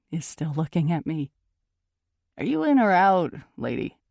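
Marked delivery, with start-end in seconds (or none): 2.4-4.0 raised, louder question, dropping on "lady"; impatient bouncer. A narrator's reading. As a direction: press them for an answer, impatiently. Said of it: "the bouncer says impatiently".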